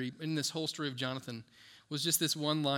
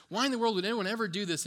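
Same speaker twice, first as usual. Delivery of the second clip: raised, fast, indignant retelling of an angry king; David's angry outburst at the story, fast and raised; 5 semitones higher, 4 dB louder, faster than usual. Retold or imitated. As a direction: fire it off fast and louder, outraged. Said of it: "David gets all angry and says".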